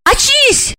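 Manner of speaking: short urgent calls, rising high; high final plea to wake; frantic calling. A narrator's reading. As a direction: try to rouse him, frantically. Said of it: "со стервенением затормошила его Ольга".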